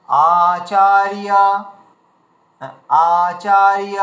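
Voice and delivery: আচার্য broken off and stretched slowly; slow syllable split. Shown as a demonstration. Teacher drilling Sanskrit students, slow careful syllables. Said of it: "এই পর্যন্ত নিতে হবে".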